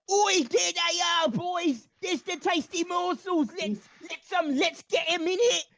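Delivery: high, loud cockney rat yell; a battle cry for the rats, pitched high; rat shouting. Acted. Rat Radicus urging the rats on, loud high shout. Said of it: "Radicus shout".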